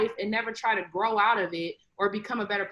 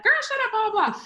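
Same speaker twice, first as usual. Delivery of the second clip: high, playful phone-chat imitation; gossiping with friends; 12 semitones higher, 4 dB louder, faster than usual. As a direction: mimic a mom squealing with her girlfriends. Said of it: "talking to your friends".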